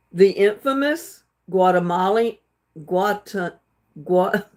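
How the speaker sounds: stumbling over Guatemala, breaking off at Gua-; real stumble on Guatemala, cut short; tongue-twisted. Real, not acted. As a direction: trip on Guatemala, restart, then give up. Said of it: "keep getting my tongue twisted".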